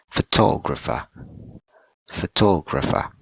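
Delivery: "photographer" with a strong beat on "tog"; stress on second syllable. Shown as a demonstration. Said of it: "stress word at the second syllable".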